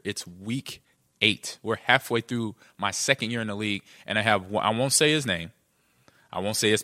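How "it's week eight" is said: "It's week eight" slowly, each word set apart; slow it down so "week eight" lands; spelled out clearly. Real, not acted. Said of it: "Let me make that clear".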